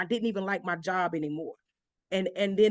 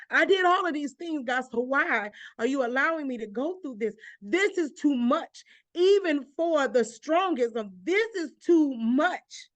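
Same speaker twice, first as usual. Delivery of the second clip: pleading with God, voice rising and stretched out; crying out to God; 5 semitones higher, 3 dB louder than usual. Retold or imitated. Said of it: "I'm crying and I'm saying".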